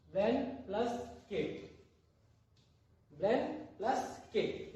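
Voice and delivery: slow, with blank and केट kept apart; blank plus केट spelled out syllable by syllable, slowly; split into syllables. Shown as a demonstration. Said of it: "blank को आप अलग बोलते हो और ये केट को अलग". (शब्दांश अलग-अलग करके धीरे)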